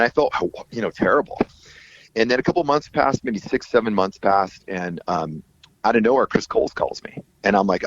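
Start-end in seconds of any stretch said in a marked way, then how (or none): none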